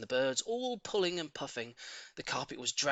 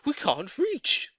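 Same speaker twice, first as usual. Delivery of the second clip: monkeys asking; 13 semitones higher, 7 dB louder, much slower than usual. Acted. Monkeys ask how to climb.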